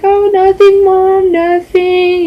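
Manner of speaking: very high, drawn-out innocent denial; feigned innocence. Retold or imitated. Daughter covering up to mom about the piercing, high fake innocence. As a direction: sing it high, playing innocent.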